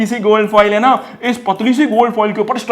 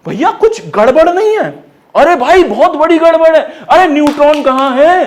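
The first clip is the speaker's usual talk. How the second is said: mock alarm; 8 semitones higher, 6 dB louder than usual. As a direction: raise the alarm like a shocked student. Acted alarm about missing neutron.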